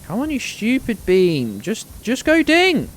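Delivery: high, slow, strained plea; frustrated pleading. Real, not acted. Streamer begging a game beam, high and drawn out. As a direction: beg the beam to ding.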